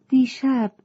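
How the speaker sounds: high sad opening word, dropped at once. A narrator's reading. Fish tells the rescuers her sad news, mournfully. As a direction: start with a sorrowful cry of دیشب. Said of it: "ماهی با ناراحتی گفت".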